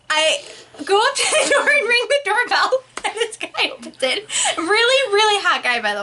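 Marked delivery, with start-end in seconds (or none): none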